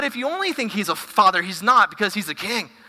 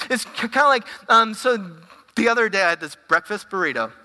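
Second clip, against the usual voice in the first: usual speaking voice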